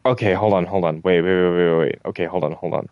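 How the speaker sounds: usual speaking voice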